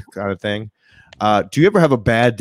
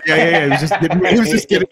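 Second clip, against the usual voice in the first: laughing; 6 semitones higher, 5 dB louder than usual. Real, not acted. Joking about popcorn.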